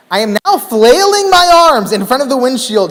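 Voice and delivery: usual speaking voice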